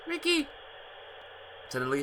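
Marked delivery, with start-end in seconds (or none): 0.1-0.5 put-on high girlish cry of his name; high girlish call, relieved. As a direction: call out for Ricky like a scared girl. [name] cries out on phone.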